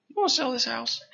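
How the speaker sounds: high, curious stranger asking out of nowhere; surprising stranger. Retold or imitated. Stranger on the porch asks to buy the house, high and curious.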